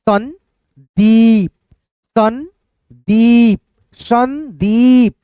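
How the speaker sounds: संदीप with the long ई stretched very slowly; drawn-out vowel. Shown as a demonstration. Teacher showing the long vowel sound to pupils, stretched. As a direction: hold the ई in संदीप long and slow. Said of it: "हमारा उच्चारण खींचता हुआ आ रहा है".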